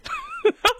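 squealing laugh way up high; helpless high laughter; high shrieking laugh. Real, not acted. Friends laughing hard.

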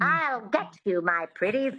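twangy witch voice starting high; witchy twang demonstration; Wicked Witch. Shown as a demonstration. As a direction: slip into a witch's twang to set the accent. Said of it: "that twang is really important".

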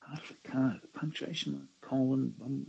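soft, half-whispered musing; quietly puzzling it out; thinking aloud. Real, not acted. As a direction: murmur the clue over to yourself. Puzzling over the quiz question.

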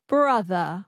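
transatlantic Brother with no final r; transatlantic accent. Shown as a demonstration. Learner echoing the coach, transatlantic accent. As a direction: copy a non-rhotic Brother. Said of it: "the transatlantic accent".

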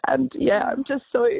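high, choked-up thankfulness; real, emotional. Real, not acted. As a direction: voice rising as emotion takes over. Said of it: "Sorry, I'm so emotional this morning".

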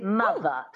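MOTHER ending in a schwa, no R; dropped R. Shown as a demonstration. Coach modelling transatlantic, mother without R. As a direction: drop the R in MOTHER. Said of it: "you drop the R and you make it a schwa".

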